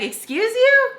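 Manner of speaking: high, loud offended question; acted outraged disbelief; indignant retort. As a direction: pitch it way up, affronted. Acted indignant retort to Dick's remark.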